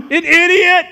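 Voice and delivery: high, loud insult hurled at a slow driver; road rage yell. Retold or imitated. Stuck behind a slow driver, yelling in anger. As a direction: blast it at the car ahead.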